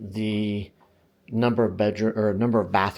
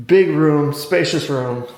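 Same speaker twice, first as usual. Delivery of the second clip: gushing real estate agent; 4 semitones higher, 7 dB louder, slower than usual. Pretend. Hyping the room like a listing.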